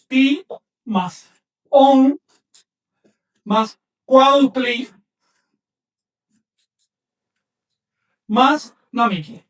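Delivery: "más cuautli más namiki" said very slowly, piece by piece; slow breakdown. Shown as a demonstration. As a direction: build the word slowly from its parts. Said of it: "Y aquí lo dice".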